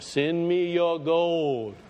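slow, stretched-out money appeal; mock televangelist. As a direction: intone it like a TV fundraiser, dragging the words. Mimics TV preacher asking for gold.

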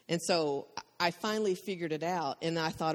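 ordinary delivery

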